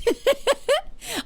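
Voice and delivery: a high, sudden laugh at herself; burst of laughter. Real, not acted. To chat, laughing at the fake chat alert. Laughs at her own disappointment.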